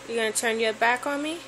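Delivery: usual speaking voice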